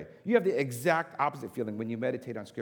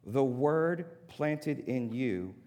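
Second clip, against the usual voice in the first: very slow stress; much slower than usual. Shown as a demonstration. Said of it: "this is really critical".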